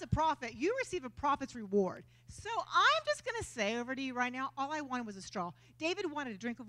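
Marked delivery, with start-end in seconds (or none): none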